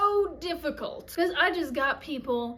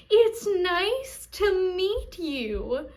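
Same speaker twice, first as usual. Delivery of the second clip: very slow, high, creepy-sweet imitation; eerily drawn-out mock delight; over-happy greeter; 4 semitones higher, much slower than usual. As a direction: drag out every word like an unhinged greeter. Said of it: "She goes".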